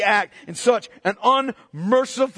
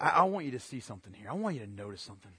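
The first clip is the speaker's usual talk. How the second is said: fast, much softer confiding; hushed aside; 12 semitones lower, 12 dB quieter, much faster than usual. Real, not acted. Preacher drawing the congregation close, fast and hushed. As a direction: drop to a near-whisper to draw them in. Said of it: "leaning in to congregation".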